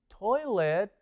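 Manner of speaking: ordinary delivery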